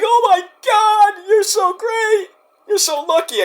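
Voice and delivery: very high, drawn-out mock gushing; imitated gushing fans. Crowd coming up to the performer off stage, mock gushing. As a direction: squeal it like a starstruck fan. Acting out admirers after the show.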